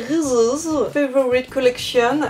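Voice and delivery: playful put-on English accent; mock English accent. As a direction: say the brand name with a joking English accent. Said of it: "mon super accent anglais". (accent anglais exagéré pour rire)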